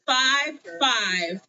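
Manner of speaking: slow drawn-out number call; bingo caller. Acted. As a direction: call each digit to the room. Calling bingo number slowly.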